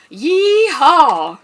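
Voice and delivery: high, loud yee-haw; cowboy whoop. Pretend. Librarian voicing story animal, loud high whoop. Cow character exclaims.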